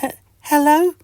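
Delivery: high, hesitant little hello; tiny creature testing if he is heard; timid tiny voice. Acted. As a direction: call out shyly like a small mite. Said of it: "says Mister Itch".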